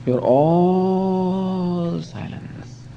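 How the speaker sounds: very slow, soft, drawn-out sage voice; serene swami. Retold or imitated. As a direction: stretch every word out like a calm holy man.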